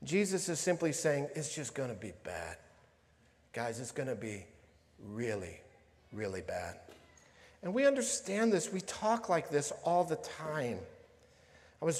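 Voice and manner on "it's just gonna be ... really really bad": low, hushed, measured warning; quietly paraphrased sober warning; grave understated warning. Retold or imitated. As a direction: drop low and soft, pausing before each blow.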